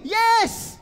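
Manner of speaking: loud, high exclamation; excited yes. Real, not acted. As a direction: burst out with the answer. Pastor answers own question.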